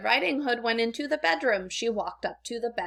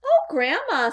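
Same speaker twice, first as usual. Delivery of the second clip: put-on higher, louder wondering girl; little girl; 7 semitones higher, 6 dB louder than usual. A narrator's reading. Girl at the bedside exclaims to Grandma, higher.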